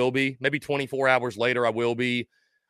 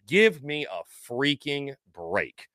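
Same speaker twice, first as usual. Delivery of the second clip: slow, emphatic exasperation; slow and deliberate, thoroughly exasperated; 4 dB quieter, slower than usual. Real, not acted. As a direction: drag out each word, fed up. Exasperated repeat to critical commenter.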